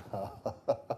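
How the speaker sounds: soft laugh. Real, not acted. Laugh after pointed line.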